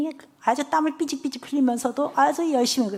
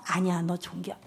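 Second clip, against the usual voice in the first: soft reassurance; 6 semitones lower, 9 dB quieter than usual. Retold or imitated. Teacher reassuring the child.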